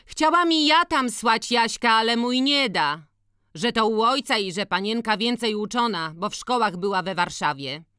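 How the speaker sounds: high-pitched village woman; chatty peasant sister. A narrator's reading. Siostra opowiada o synu. (wysoki głos wiejskiej kobiety)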